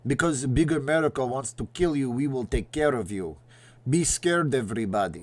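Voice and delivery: put-on Russian accent, warning the people; hammed-up Russian accent, Soviet propaganda style. Soviet Union scaring its people, Russian accent. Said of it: "That's my Russian accent".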